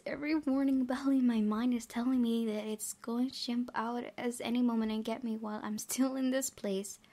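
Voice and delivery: usual speaking voice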